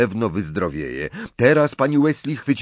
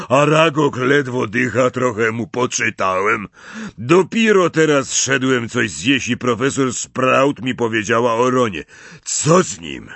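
big, louder half-giant voice, a touch worried; Hagrid's gruff voice; 4 semitones higher, 4 dB louder than usual. A narrator's reading. Hagrid asking the boys about Ron, louder, concerned. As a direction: speak like a kind giant asking about a friend.

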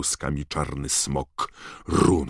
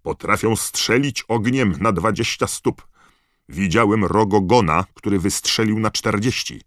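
loud and higher, still warning; shouting; 6 semitones higher, 6 dB louder than usual. A narrator's reading. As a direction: call out over the noise. Keeper shouting warning near dragons.

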